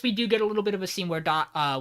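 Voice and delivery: ordinary talk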